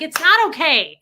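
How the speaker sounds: loud, high protest; retold outburst. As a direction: throw it back at him, upset. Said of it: "And I was like".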